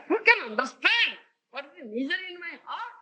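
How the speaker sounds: high-pitched plaintive appeal, lightly acted; crying lament. Guru voices Mahaprabhu crying for Krishna, high plaintive lament. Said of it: "He was crying like that".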